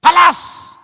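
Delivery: imitating a wife shouting at her husband; wife's shout. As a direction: bark the order like a furious wife. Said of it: "Dia bilang gini".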